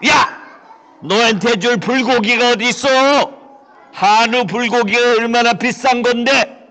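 loud, rising outrage; indignant scolding. Acted. Rich man refusing the beggar expensive beef.

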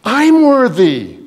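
high, loud, slow proclamation; God's declaration. Retold or imitated. God voiced declaring his own worth, high and loud. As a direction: proclaim it with triumph. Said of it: "He agreed with him".

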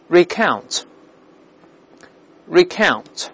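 "recount" as ri-KOWNT, stress on the second syllable. Shown as a demonstration. Teacher modelling for repetition, "recount" stressed on second syllable. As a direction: say "recount" carefully with a wide open ow, falling. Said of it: "重音在第二个音节".

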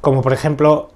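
ordinary delivery

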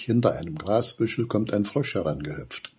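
ordinary delivery

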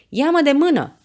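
high, loud rescuer's command; urgent shout. A narrator's reading. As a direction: call it out to someone dangling. Rescuer calls to hanging Meda. (strigăt imperativ, urgent)

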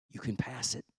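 quiet encouragement to a struggling son; soft reassurance. Retold or imitated. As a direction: tell him gently he can pass it. God's gentle reassurance, said twice.

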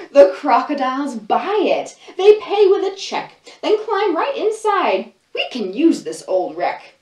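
put-on lively read-aloud for little kids, voices and all; storybook reading. Librarian reading a picture book to children, animated storytelling.

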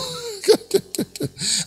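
quiet amused chuckle; light laugh; soft high laugh. Real, not acted. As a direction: laugh at the prophet. Preacher laughs.

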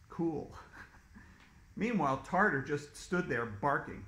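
usual speaking voice

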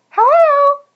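very high, loud squeaky hello; calling out. A narrator's reading. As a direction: call loudly into the hole as a tiny worm. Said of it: "coming through the enter of his hole".

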